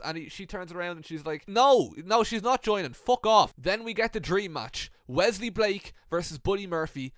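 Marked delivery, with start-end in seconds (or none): 1.5-3.5 loud, higher mock-indignant refusal; angry refusal. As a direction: shut them down loudly, acting furious. Rhea's angry refusal acted out.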